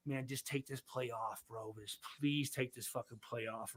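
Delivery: mock-nervous muttered prayer; quiet pleading mutter. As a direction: plead under your breath for him to sit out. Pleading under breath retold.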